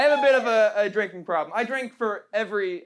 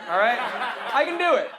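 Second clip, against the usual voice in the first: normal talking voice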